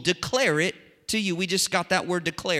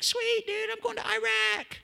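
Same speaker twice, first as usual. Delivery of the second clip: very high, put-on buddy reply; imitating a friend. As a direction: play the pal in a mock high voice.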